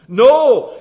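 emphatic no. Real, not acted. Preacher's emphatic rebuttal.

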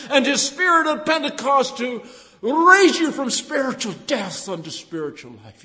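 loud, high, fervent preaching; preaching at full force. Acted. Preacher exhorting the congregation, loud and fervent. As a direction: proclaim it to the back row.

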